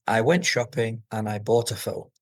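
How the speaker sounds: "bought a phone" stepping down to a low close; falling intonation. Shown as a demonstration. Teacher showing hand-guided lowering of intonation, falling end. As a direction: start firm and drop down onto "phone". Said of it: "lower your intonation as well".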